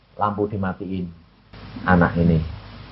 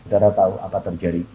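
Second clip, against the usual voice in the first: ordinary talk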